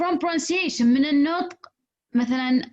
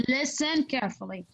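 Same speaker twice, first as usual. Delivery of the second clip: "listen" stretched out for emphasis; stress on listen; 2 semitones higher, 4 dB quieter than usual. Shown as a demonstration. Teacher repeating her example for the class, stress on "listen". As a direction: hold and press the word "listen". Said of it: "شوفوا كيف أنا جالسة أنطق".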